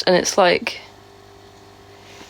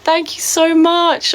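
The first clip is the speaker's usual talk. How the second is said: grateful thanks; 8 semitones higher, slower than usual. Real, not acted. Thanking a viewer for a gift.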